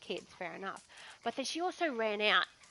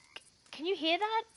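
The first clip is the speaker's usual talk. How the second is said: higher, alarmed little question; startled nurse; 8 semitones higher than usual. Retold or imitated. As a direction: pretend to hear something odd. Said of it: "To which she says".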